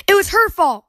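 put-on high, loud child's accusing shout. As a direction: yell the blame at her. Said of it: "pointing at each other and shouting".

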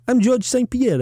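French Canadian accent. Shown as a demonstration. Doing the accent the co-host asked for, as George Saint Pierre. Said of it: "do the accent".

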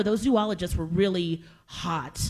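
ordinary talk

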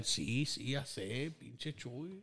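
quiet grumbling mom imitation; muttering mom. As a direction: mumble annoyed like mom. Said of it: "my mom's all mad".

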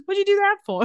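high-pitched puzzled protest; confused. Retold or imitated. Confused Henry questioning what was just done, high and puzzled. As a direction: ask it high, like you're baffled. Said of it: "Poor Henry's confused every time".

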